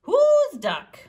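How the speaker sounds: high, loud demanding call. Acted. Asking the duck whose it is, high and loud. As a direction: insist on an answer.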